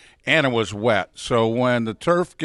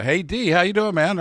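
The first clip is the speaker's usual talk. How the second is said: cheery greeting; 8 semitones higher than usual. Retold or imitated. Voicing Stargell's greeting at first.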